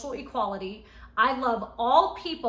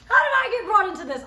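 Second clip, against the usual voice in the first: much higher, louder and fast; indignant surprise; 10 semitones higher, 9 dB louder, faster than usual. Real, not acted. Reacting to being named in the accusation, surprised. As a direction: blurt it out in disbelief.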